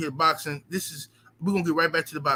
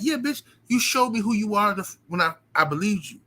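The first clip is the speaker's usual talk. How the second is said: ordinary talk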